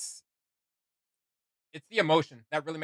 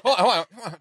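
eager; 6 semitones higher, 7 dB louder, much faster than usual. Real, not acted. Streamer pausing the clip, eager to replay. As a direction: hold on, louder and higher, eager. Stopping video excitedly.

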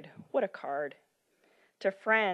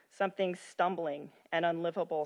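ordinary delivery

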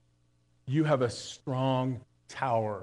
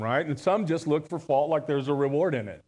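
ordinary delivery

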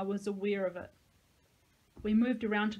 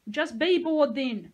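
loud, slow exasperated yell; irritated yell; 6 semitones higher, 9 dB louder, slower than usual. A narrator's reading. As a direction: snap back at her. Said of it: "I yelled".

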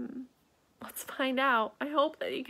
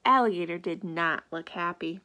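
ordinary delivery

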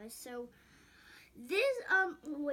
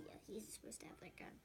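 whispering; 16 dB quieter, faster than usual. Real, not acted. Kid whispering while setting up toys.

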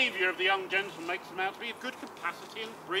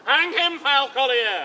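loud, higher furious oath; angry curse; 4 semitones higher, 9 dB louder, slower than usual. Acted. Sir Toby cursing the devil loudly. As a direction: spit it at the devil.

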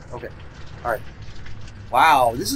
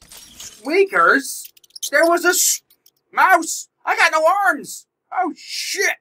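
much higher pitched, startled yelps; startled squeal; 11 semitones higher, slower than usual. Real, not acted. Player startled by a mouse in game, high squeals.

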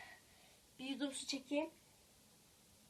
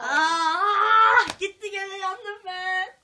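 dismayed cry; 5 semitones higher, 13 dB louder, much slower than usual. Real, not acted. Loses round again.

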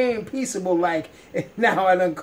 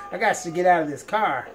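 ordinary delivery

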